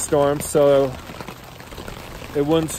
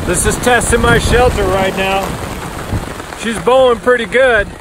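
loud, raised voice over the storm; excited shouting; 7 semitones higher, 5 dB louder than usual. Real, not acted. Camper watching tarp bow in storm, loud excited. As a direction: shout over the wind and hail.

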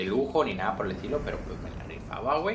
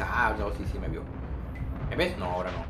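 panicking gamer; 7 dB louder than usual. Real, not acted. Hiding from enemy, taunting.

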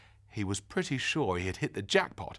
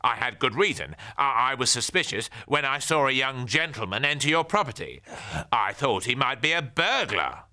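defensive neighbour; 5 semitones higher, 7 dB louder than usual. Acted. Neighbour excuses himself.

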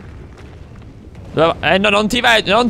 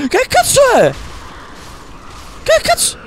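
shocked outburst. Real, not acted. Gamer startled by a monster, high shocked yelp. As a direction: jump out of your skin, voice flying up.

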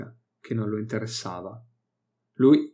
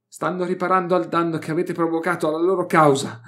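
raised, lecturing scholar voice; Malait's gloating voice; 6 semitones higher, 4 dB louder than usual. Acted. Xenologist scolds the captives, raised and gloating. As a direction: talk down to them, louder and higher.

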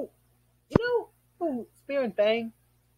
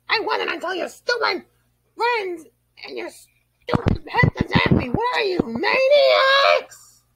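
from calm straight into a furious, louder yell; angry outburst; 2 semitones higher, 5 dB louder, slower than usual. Acted. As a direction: let the rage burst out, biggest on maniacs. Calm apology erupts into rage.

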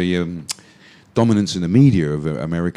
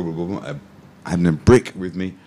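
ordinary delivery

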